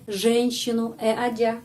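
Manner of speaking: normal talking voice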